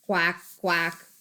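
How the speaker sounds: voiced duck quacking. Quacking like ducks.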